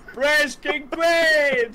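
high, long-drawn shout; playful, slow, high-pitched proclamation; mock battle cry. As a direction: stretch it out like a rallying cry.

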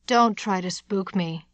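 usual speaking voice

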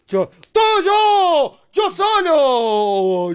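very loud, long-drawn victory yell; triumphant shout. Real, not acted. Player crowing over a solo win to partner, triumphant shout. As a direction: bellow the boast, dragging out each word.

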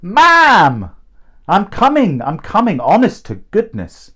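high, loud call that settles as it goes; a mother hurrying to the door, calling back to her son. A narrator's reading. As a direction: answer a child from down the hall. Mum calls back from inside.

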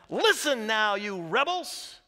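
loud, high rebuke, rebels slowed; angry Moses. Retold or imitated. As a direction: scold the crowd as furious Moses. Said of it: "he said to them".

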